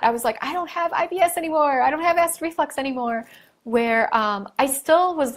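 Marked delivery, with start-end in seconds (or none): 0.4-3.3 high, bright-eyed quote of her own joy; replaying her relief, higher and a little quicker; excited retelling. To her viewers, recalling her own relief: a higher, excited quote.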